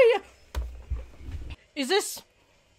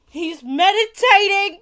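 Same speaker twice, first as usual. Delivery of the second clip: gasp, then slow, loud, higher delight; excited gasp; 5 semitones higher, 6 dB louder than usual. Real, not acted. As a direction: blurt it out in amazement. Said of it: "a lot of shouting this episode".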